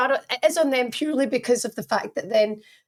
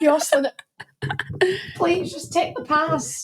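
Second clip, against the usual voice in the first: slow, higher plea to take the pass; pleading coach; 6 semitones higher than usual. Retold or imitated. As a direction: beg the players to just take the pass. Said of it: "Gu-, guys".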